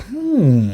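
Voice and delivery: long, high questioning hum; mock curious "hmm"; puzzled hum. Imitating puzzled Demodogs searching.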